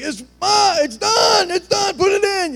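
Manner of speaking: very high, loud mock-frantic yell; impatient shouting. Kids see a bubble in the pasta water, impatient shouting. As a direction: holler it like a desperate hungry kid. Hungry family yelling at mom, acted.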